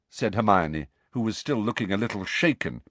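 plain reading voice

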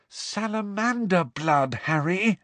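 high, slow exasperated moan. A narrator's reading. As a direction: moan it at a careless friend. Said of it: "Hermione moaned".

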